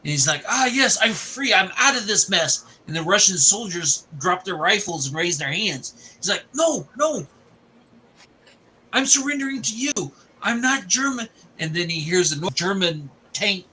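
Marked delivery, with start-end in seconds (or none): 0.5-2.6 higher-pitched acted relief; retold relief. Escapee acted out reaching safety. 6.5-11.3 high-pitched pleading, retold; acted desperate protest. Escapee protests to surrendering soldiers.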